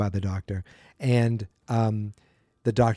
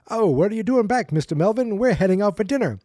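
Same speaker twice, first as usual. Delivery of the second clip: high polite servants; 8 semitones higher than usual. Retold or imitated. Butler and maid voiced.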